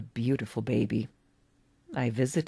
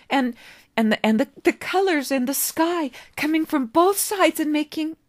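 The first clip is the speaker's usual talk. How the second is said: put-on much higher, tumbling boyish voice; a boy falling over his words in wonder; excited stammering boy; 13 semitones higher, 5 dB louder than usual. David excitedly describes the colours to the old man, stammering boy voice.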